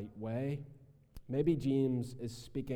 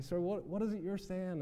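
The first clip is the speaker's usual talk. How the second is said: confused asking back; 7 semitones higher than usual. Retold or imitated. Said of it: "and then eventually I'd say".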